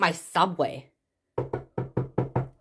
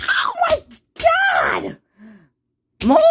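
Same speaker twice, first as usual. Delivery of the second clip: high, slow panicked wail; panicked shout; slower than usual. Acted. Mario reading the eviction threat, panicked wailing. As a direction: wail it out like the world is ending. More bills.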